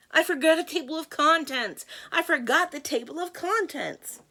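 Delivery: high, loud fluster; high-pitched, frantic confession; alarmed cry. Acted. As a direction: cry it out in sudden panic. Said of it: "cried Jack the narrator".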